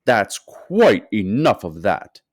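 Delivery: slow, loud stern shopkeeper; firm shout. A narrator's reading. Fat shopkeeper shouting at the crowd to stop, slow and loud. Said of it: "the fat shopkeeper shouted".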